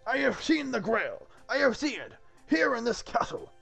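high-pitched, emphatic knightly declaration; urgent insistence. Acted. Galahad tells Dingo of the Grail, urgent insistence.

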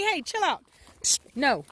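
normal talking voice

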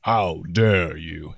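low, slow lordly offence; Dragon Lord Mist. Pretend. Actor answers friend's warning in character, low slow dragon lord. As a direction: draw it out deep, mock-affronted. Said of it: "So for Dragon Lord Mist".

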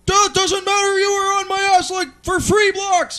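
high, loud mock-cop rant; exaggerated irate officer impression; shouting cop. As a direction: shout the comeback up high, stretching the end. Cop retold in roadside quarrel.

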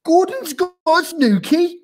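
very high, loud, incredulous roar. A narrator's reading. Tiger passes gossip to the seal, roaring. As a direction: roar the question onward. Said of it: "roared the tiger".

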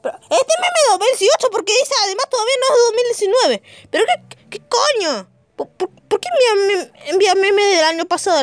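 high-pitched outraged complaining; exasperated rant. Real, not acted. Outraged at the old meme.

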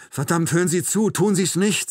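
loud, high, exasperated urging; urgent plea. A narrator's reading. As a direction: push hard to make him listen.

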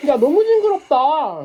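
loud, slow grossed-out cry; disgusted exclamation. Real, not acted. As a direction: recoil in disgust. (징그러워하며 크게)

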